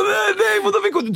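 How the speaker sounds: put-on very high, scared little boys' voices; scared kids. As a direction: squeak it like terrified small boys. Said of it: "De blir ju skiträdda".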